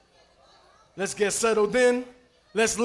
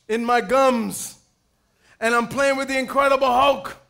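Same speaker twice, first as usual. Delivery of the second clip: ordinary delivery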